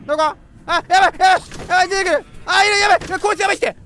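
high, loud panicked yelps; startled real fear. Real, not acted. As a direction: blurt it out as the monster appears. Jump scare in horror game.

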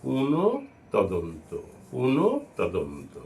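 পুনঃ, then তদন্ত, split apart; syllable by syllable. Shown as a demonstration. Teacher drilling students: split pronunciation. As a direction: break পুনঃ তদন্ত into its two parts. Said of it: "উচ্চারণ".

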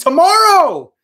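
thrilled the figure ships tomorrow; excited. Real, not acted. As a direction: squeal the date out in delight. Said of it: "Sorry, I'm getting a little excited here".

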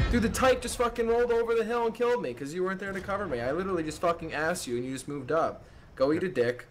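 angry high rant; cover me shout. Real, not acted. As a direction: vent at the teammate, then storm off. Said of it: "I think he's upset".

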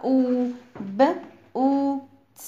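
"او" held long as "oo", then "ب او ت" sounded out. Shown as a demonstration. Teacher showing double O has long sound, "oo" drawn out. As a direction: split boots into b, long "oo", ts with pauses. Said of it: "ليها صوت طويل".

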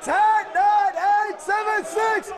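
barked countdown; shouted countdown. Real, not acted. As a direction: count down yelling at recruits. Drill instructor counting down.

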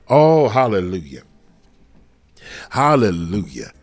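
loud ringing praise; joyful hallelujah. Real, not acted. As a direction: shout it out to God. Praise shout in sermon.

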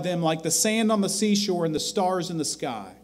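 a fervent pulpit crescendo; preaching at full force. Acted. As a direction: swell the list of God's promises toward a peak. Preaching builds to climax.

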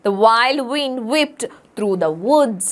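very slow, each W word leaned on; slow alliteration reading. Shown as a demonstration. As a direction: drag it out for the class. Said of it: "അതും വ് sound വന്നു".

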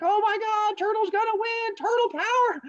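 very high falsetto crowd cheer; hammed-up high-pitched screaming excitement; excited cheering. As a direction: squeal like tiny animals cheering on their champion. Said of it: "start cheering".

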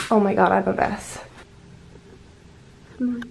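ordinary talk, nothing marked

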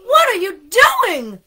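high, very loud outraged yell; indignant shout. A narrator's reading. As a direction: shout it in shocked protest. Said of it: "shouted Arnie".

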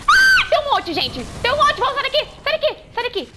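high frantic shouts, half laughing; panicked shouting. Real, not acted. Swarmed by enemies.

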